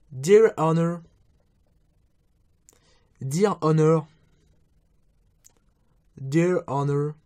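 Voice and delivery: English wake phrase with a French accent, spaced out. Real, not acted. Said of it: "avec mon super accent anglais".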